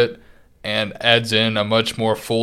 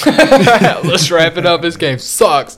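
high, loud mock verdict; mock outburst; 7 semitones higher, 7 dB louder, much slower than usual. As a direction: declare it loudly in joking disgust. Jokingly mocking co-host's harsh verdict.

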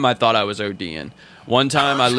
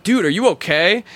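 worried friend; 9 semitones higher than usual. Retold or imitated. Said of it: "they're like".

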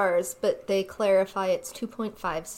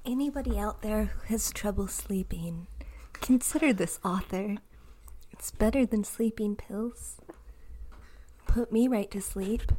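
whispered ad; 3 dB quieter than usual. Pretend. Mock sleep-aid ad whisper.

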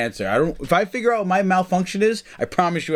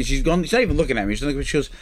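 ordinary talk, nothing marked

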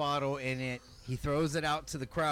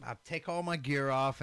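ordinary talk

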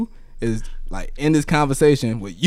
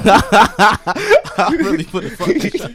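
higher, slow, laughing hard; 10 semitones higher, 6 dB louder, slower than usual. Real, not acted. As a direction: squeeze it out through laughter.

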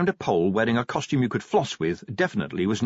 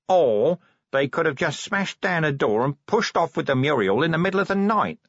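higher-pitched, informal corporal voice; matter-of-fact corporal; 7 semitones higher, 3 dB louder than usual. Acted. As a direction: counter with a simpler idea. Corporal offers simpler theory.

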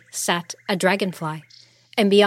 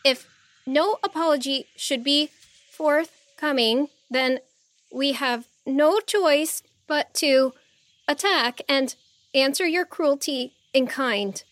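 high, halting formal ultimatum; brave; 7 semitones higher than usual. A narrator's reading. Heroine threatening the Monkey King with attack, bravely formal. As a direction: press on bravely in careful, high-pitched phrases. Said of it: "then bravely continued".

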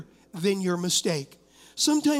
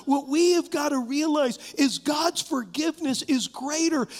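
preaching at full force; 5 semitones higher than usual. Acted. Preacher urges the congregation that God forgives, at full force. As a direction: drive the promise home to the congregation, rising.

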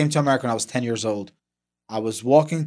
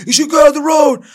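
put-on high, loud angry shout at a kid; angry yell; 12 semitones higher, 9 dB louder than usual. Motorcyclist yelling at a seven-year-old, angry shout. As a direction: yell it like an angry biker scolding a child. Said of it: "starts yelling at me".

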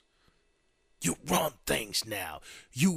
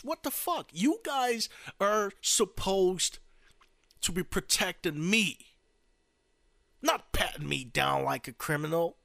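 high-pitched, affronted complaint; indignant protest; 5 semitones higher than usual. Acted. New president scolding Secret Service who search him, high indignant protest. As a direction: remind them of their job, affronted.

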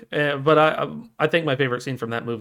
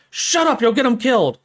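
high, loud panicked scolding; mock angry shout; 12 semitones higher, 6 dB louder than usual. Townsperson silencing the old lady, mock angry shout. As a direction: shout her down in hammed-up alarm.

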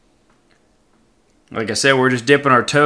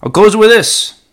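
a hint of an Obama cadence, higher and louder; sounded like Obama; 9 semitones higher, 8 dB louder than usual. Closing the podcast to his friends, light Obama impression. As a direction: slip into a light Obama-style delivery. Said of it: "that sounded like Obama".

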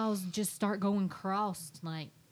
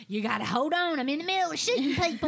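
louder, higher and fast, impersonating her husband mid-game; mock distracted gamer husband; 9 semitones higher, 7 dB louder, faster than usual. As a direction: brush off the kids, eyes on screen.

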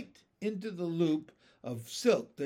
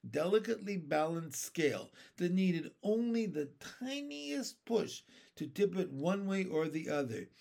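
ordinary delivery, nothing marked

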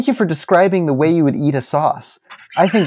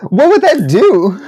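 silly put-on voice; 9 semitones higher, 7 dB louder, slower than usual. Teasing retort in silly voice.

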